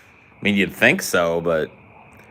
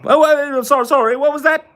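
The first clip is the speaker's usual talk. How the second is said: high and loud flustered British voice; a startled Brit caught off guard; mock British accent; 7 dB louder than usual. Gamer's mock Brit caught off guard, in a high, loud British accent. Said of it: "this British thing is hard".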